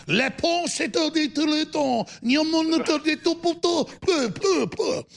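high-pitched fake French gibberish; mock French. Host showing the Quebec caller his French, high mock accent. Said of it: "So I can speak French".

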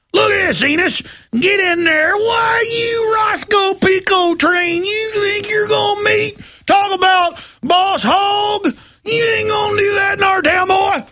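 shrill cartoon sheriff yelling at a protester. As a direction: lampoon a bullying small-town deputy. Mocking a small-town Boss Hog cop.

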